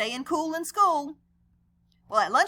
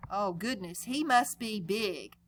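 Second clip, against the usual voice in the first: usual speaking voice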